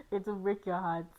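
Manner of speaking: ordinary talk, nothing marked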